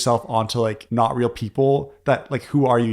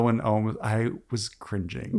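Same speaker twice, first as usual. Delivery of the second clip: murmured aside; 3 semitones lower, 8 dB quieter than usual. Real, not acted. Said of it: "quiet muttered aside".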